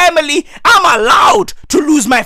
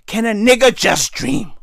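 ordinary talk

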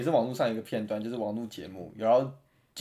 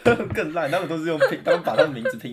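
laughing; 4 semitones higher, 6 dB louder than usual. Real, not acted. Laughing while mocking chants.

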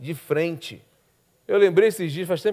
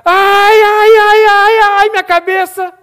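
put-on much higher, louder, whining child; whiny child; 13 dB louder, much slower than usual. Mocking the son complaining to his father, whiny child voice. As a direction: wail like a sick little boy. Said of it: "teu filho chegar".

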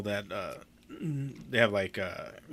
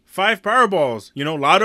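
mock exasperated customer; 6 semitones higher, 8 dB louder than usual. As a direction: spell it out for a clerk who isn't getting it. Retold customer spelling out his order.

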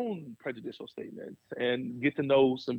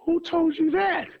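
high, incredulous mock retort, voice cracking on the question; board members' retort; 12 semitones higher, 4 dB louder than usual. Board members asking their pastor, high and incredulous. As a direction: play the board firing back, pitched up and disbelieving. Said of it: "they go".

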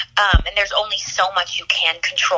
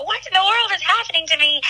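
high, tearful cry of disbelief; sobbing disbelief; 12 semitones higher than usual. Retold or imitated. Retelling her own tearful moment to the interviewer, high sobbing disbelief. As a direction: voice jumps up high as she sobs out the question. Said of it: "And so I'm sobbing".

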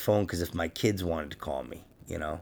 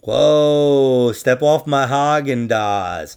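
loud, slow, playful warning; mock threat; 4 semitones higher, 7 dB louder, much slower than usual. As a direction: back off, hammed-up tough guy. Mock threat over ice cream.

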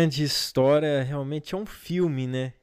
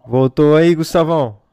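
calling from far away; 11 dB louder than usual. Real, not acted. Call dropped, calling to guest.

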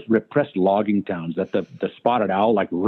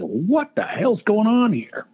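slow disbelief; 10 semitones higher, much slower than usual. Retold or imitated. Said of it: "I said".

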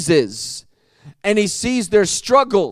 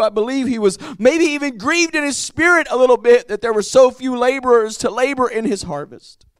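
normal talking voice

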